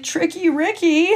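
high, whiny put-on voice of a teammate about to cry; mock crying teammate. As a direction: whimper it out like a grown man pretending to bawl. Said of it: "he's like".

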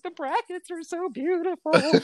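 high, tearful, laughing wail; mock sobbing. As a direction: cry it out while half laughing. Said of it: "And I'm crying".

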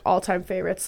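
ordinary talk

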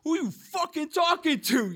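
high, loud retold comeback; mock aggressive kid. Retold kid snapping back at him.

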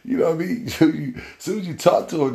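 high, loud laughing delivery. Real, not acted. Joking about hot breath, laughing loud. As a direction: crack up while telling it.

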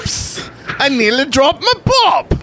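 high-pitched, badly faked English accent; horrible English accent. Host botching a sponsor's requested line, bad English accent. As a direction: squeal it in a terrible English accent. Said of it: "that was a horrible English accent".

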